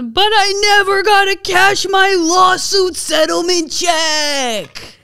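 a high, loud, slowed cry from off screen; off-screen shout. Acted. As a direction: yell it while falling from the sky. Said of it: "Vinny off screen says".

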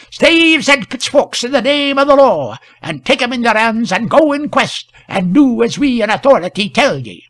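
high, loud officious constable; pompous loud command. A narrator's reading. Constable orders the men to arm, loud and pompous. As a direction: proclaim it in the law's name.

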